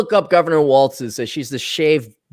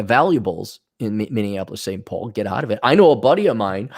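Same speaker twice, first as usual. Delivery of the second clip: ordinary talk, nothing marked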